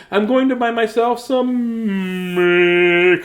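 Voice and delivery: high, loud, drawn-out sung ending; hammed-up high wail on the final word; Julie's silly voice. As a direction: announce it grandly, stretching the last word. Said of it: "why she had a voice like that".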